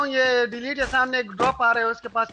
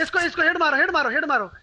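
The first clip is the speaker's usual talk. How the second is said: high, excited repeated order; real gamer urgency, pitched up; urgent command; 5 semitones higher, 3 dB louder than usual. Real, not acted. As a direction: urge a teammate to take the shot. Urging teammate to shoot.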